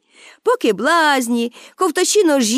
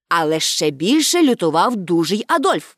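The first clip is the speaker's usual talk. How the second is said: plain reading voice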